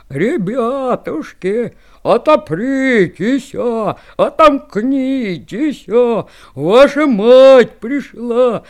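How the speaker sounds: slow, loud, rough wolf singing; gruff wolf voice. Acted. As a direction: growl the song out slowly as the wolf. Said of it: "а волк грубым голосом и запел".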